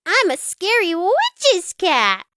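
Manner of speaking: high, bright playful cat voice; excited cry. A narrator's reading. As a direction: show off the costume with glee. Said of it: "cried Splat".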